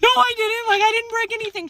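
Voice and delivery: high-pitched indignant no; childish protest. Retold or imitated. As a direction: protest like a wronged kid. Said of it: "I was like".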